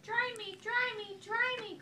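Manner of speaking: put-on very high pleading baby; very high needy chick; crying baby chick. A narrator's reading. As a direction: whine it high. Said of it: "cried the soggy baby chick".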